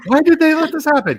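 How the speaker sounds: high, loud mock exasperation; exasperated question. Quoting husband's line, in high exasperation. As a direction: whine it at the screen. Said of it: "husband's response".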